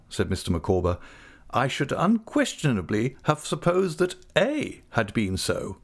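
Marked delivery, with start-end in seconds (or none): 1.5-5.8 lightly voiced pompous gentleman, pitched up; Micawber's florid speech. A narrator's reading. Micawber to his dear Copperfield: lightly voiced, florid compliments. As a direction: roll out the flowery phrases, a hint of grandeur.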